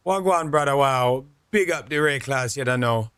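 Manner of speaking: put-on Jamaican patois greeting; Jamaican accent. Shown as a demonstration. Streamer practising for a trip to Jamaica, mock Jamaican accent. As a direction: try out a hearty Jamaican accent. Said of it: "that accent because I'm going to Jamaica".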